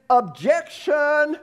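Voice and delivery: drawn-out mock courtroom cry; courtroom objection. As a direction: object like a lawyer jumping up in court. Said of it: "Like in a courtroom setting".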